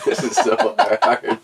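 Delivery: real giggle through the words; can't stop laughing, slowed down. Real, not acted. Breaking up mid-improv.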